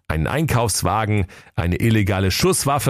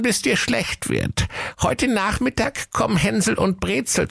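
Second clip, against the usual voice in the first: put-on very high old witch voice, coaxing; wheedling witch; 13 semitones higher than usual. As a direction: lure him with promises. Witch asks favour.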